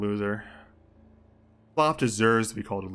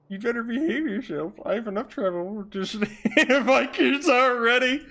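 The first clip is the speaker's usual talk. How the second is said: high, loud silly warning; put-on detective voice; 10 semitones higher, 5 dB louder than usual. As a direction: scold in a hammed-up voice, breaking into laughter. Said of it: "I'll do, like, a fucking Bane voice".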